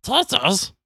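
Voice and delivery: high-pitched creature impression; Gollum. Butting into the cohost's hobbit explanation as Gollum. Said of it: "That is a Gollum".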